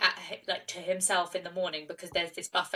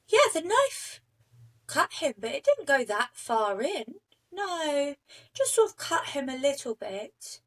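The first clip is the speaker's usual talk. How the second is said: much higher, airy and dismissive, like it's nothing; her way of talking; 6 semitones higher than usual. Retold or imitated. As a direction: shrug it off in a small, high girlish voice. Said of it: "And that's how she talks as well".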